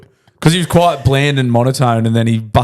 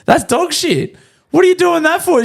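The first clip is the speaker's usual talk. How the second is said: outraged. Real, not acted. Outraged at air hostess.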